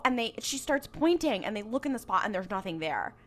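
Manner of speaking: ordinary talk, nothing marked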